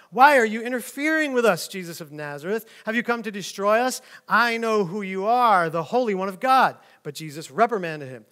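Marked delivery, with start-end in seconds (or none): 0.1-6.8 louder, higher demon outburst; demon crying out. Acted. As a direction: cry out like a cornered spirit. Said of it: "cried out".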